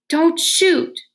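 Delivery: high, loud warning; urgent warning. A narrator's reading. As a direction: stop the shot. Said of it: "Rainey warned".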